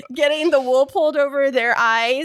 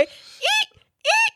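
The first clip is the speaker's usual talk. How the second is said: high squeaky eek; rubbery high squeaky toy. Pretend. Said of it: "I'm gonna start squeaking".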